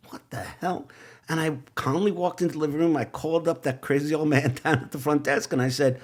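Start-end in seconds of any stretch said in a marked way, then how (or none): 0.1-0.9 softly bewildered, no panic; calm and quiet. Retold or imitated. Staring at the exploded toilet, calmly quiet. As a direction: murmur it calmly, as if unfazed. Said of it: "very calmly looking at it and saying".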